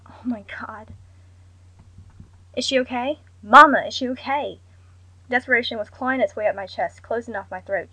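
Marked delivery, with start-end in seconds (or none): 0.1-0.9 breathless unvoiced whisper; horrified whisper. A narrator's reading. Narrator's inner horror. 2.5-4.6 rising to a loud desperate plea; desperate pleading. A narrator's reading. Daughter on the phone begging for news, desperate plea.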